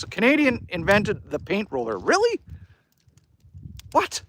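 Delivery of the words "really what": "Really? What?" jumps way up in pitch; surprised disbelief. Real, not acted. Reading a chat fact, he reacts with high-pitched disbelief. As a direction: caught off guard by the fact, with rising disbelief.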